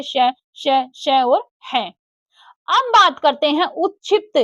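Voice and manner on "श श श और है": श, श, श drawn out slowly and hissed; teacher stretching each श so pupils hear it; slow hissed sounds. Shown as a demonstration. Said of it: "संघर्षी व्यंजन है बेटा".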